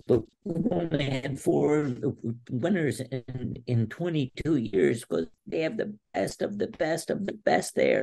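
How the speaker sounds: high, slowed imitation of a choked-up coach; retold tearful plea. Wrestler imitating his coach urging him to go, tearful. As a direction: drag the words out, pitched high, pleading. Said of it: "he's got tears in his eyes".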